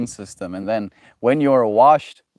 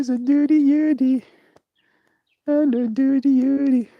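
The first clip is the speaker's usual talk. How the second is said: much higher cooing pet voice. Real, not acted. Baby talk to second dog.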